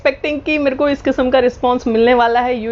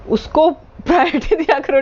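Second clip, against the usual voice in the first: laughs mid-line while quoting her husband; laughing; 6 semitones higher than usual. Real, not acted. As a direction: break into a laugh on the word.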